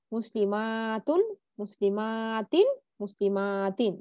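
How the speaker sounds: slow recitation stretching মা before তুন and তিন; extra-long vowel. Shown as a demonstration. Teacher reciting the plural forms for memorising, each মা drawn out long. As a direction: hold the মা long in মুসলিমাতুন and both মুসলিমাতিন. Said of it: "একটু মানে extra মাদ দিয়ে আমরা পড়লাম".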